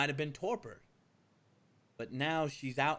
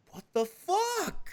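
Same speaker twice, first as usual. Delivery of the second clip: high, drawn-out, loud disbelief; shocked outburst; 5 dB louder, much slower than usual. Acted. He walks in and sees you two, shocked. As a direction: stretch it out in high-pitched shock.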